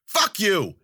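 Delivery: loud, high comeback; yelled retort. A narrator's reading. Wildcat answering the narrator's shout, yelling back. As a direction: yell it straight back at him. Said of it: "she yelled back".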